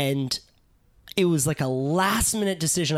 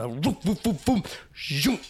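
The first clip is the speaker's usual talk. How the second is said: imitated tumbling; 3 semitones higher than usual. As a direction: mimic being dragged along the bottom. Sucked to the bottom, tumbling.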